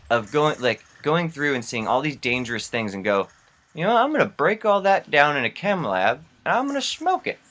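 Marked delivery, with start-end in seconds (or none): 3.7-7.4 higher-pitched mock decision, rising at the end; mock bright idea. Imagined meth inventor announces the plan, mock-eager. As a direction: pitch climbing to a squeaky punchline.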